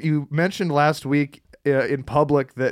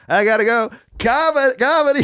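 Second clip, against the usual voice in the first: higher, bright exit line; imitating Norman leaving; 8 semitones higher than usual. Host imitating a comedian leaving the live show, higher. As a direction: toss it off while walking out. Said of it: "As he was leaving".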